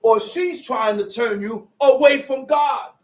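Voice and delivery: rising preacher shout; preaching. Real, not acted. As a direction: preach it with force.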